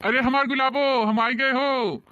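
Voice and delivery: eager lover calling his sweetheart. Acted. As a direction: call fondly from afar. Lover calls out arriving.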